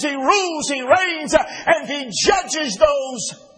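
rising, ringing pulpit cadence; preaching at full force. Acted. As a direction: build it up like a preacher nearing the peak. Preaching builds to climax.

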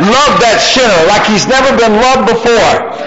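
high-pitched, driving pulpit delivery; full-force preaching. Real, not acted.

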